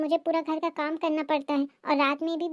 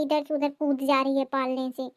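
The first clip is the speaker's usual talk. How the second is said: ordinary talk, nothing marked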